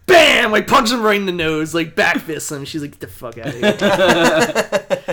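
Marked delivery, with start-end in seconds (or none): 2.9-5.1 mock telling-off. Batgirl telling bro off.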